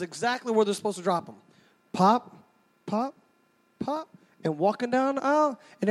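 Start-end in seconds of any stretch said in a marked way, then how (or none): none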